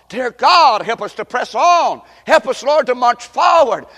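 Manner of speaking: prayer building from subdued thanks to loud pleading; fervent prayer. Real, not acted. Leads closing prayer, building in force.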